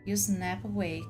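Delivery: ordinary delivery, nothing marked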